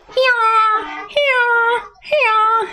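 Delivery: high-pitched donkey hee-haw; donkey braying. Pretend. Storyteller playing the donkey's sound effect, braying high. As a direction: bray like a donkey in short bursts. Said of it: "They brought the donkey".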